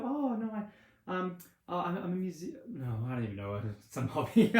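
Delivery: nervous, high-pitched date caught off guard; flustered date. Acted. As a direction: play the awkward date fumbling for an answer. Date flustered describing a hobby.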